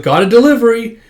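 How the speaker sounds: high, loud delivery call; delivery man. Acted. Delivery man calling at the door, high and loud. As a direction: announce it brightly at the door.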